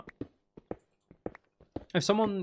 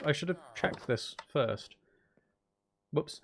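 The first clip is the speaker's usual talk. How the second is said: ordinary delivery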